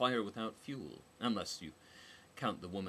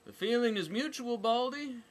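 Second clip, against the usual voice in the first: high, loud mocking jab; taunting high voice; snide retort; 9 dB louder than usual. A narrator's reading. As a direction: sneer it at her.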